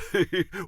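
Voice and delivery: smug chuckle. Acted. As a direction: laugh at your own wordplay. Fence chuckles at own joke.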